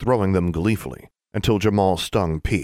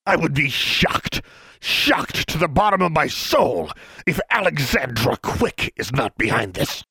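drawn-out, indignant and much higher; slow, higher pompous official; 9 semitones higher than usual. A narrator's reading. Pompous official accuses Alexandra before the class. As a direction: savour the outrage slowly.

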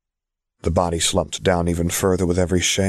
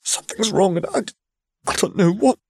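high, breaking, bewildered voice; lost and frightened; shaken young prince; 13 semitones higher than usual. Acted. Prince in shock.

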